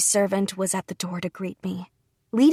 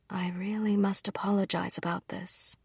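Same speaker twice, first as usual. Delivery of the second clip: softer, whispered regret; whispered apology; 6 dB quieter than usual. Acted. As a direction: apologize under his breath. Father apologizing in private.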